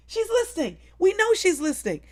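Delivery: insistent. Real, not acted.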